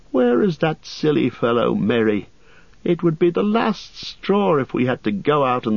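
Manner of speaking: put-on high, drowsy young hobbit voice; sleepy hobbit. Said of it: "Pippin yawned".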